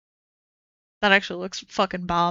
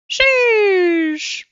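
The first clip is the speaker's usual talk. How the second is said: amazed; 10 semitones higher, 5 dB louder than usual. Real, not acted. Amazed at liquor cabinet.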